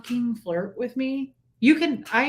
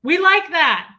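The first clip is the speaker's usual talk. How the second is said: loud, higher-pitched approving chorus; imitated delight; 5 semitones higher, 8 dB louder, slower than usual. Woman mimics women enjoying her flirting, delighted. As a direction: voice the flattered women, loud and high. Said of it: "And they're like".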